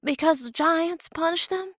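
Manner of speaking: put-on high, unsure girl's voice; young girl voice. A narrator's reading. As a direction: ask it like a scared child. Romani telling legend, high voice.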